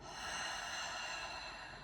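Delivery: long release sigh; audible exhale sigh. Shown as a demonstration. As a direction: sigh it all out. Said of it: "let it go".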